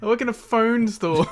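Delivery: laughing impression of a puzzled clerk; laughing quote. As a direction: play the clerk, cracking up. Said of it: "And he's like".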